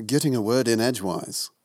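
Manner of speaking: put-on English accent; affected English accent, a little lifted. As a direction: answer as a mock Englishman. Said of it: "do an English accent".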